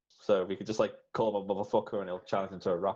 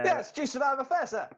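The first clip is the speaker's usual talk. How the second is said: beggar whine. Retold or imitated. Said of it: "the best one is".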